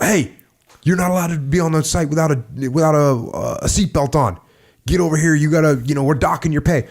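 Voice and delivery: mock stern supervisor calling someone over; aggressive reprimand. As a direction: pull up a rule-breaker, mildly heated. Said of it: "You're gonna get aggressive".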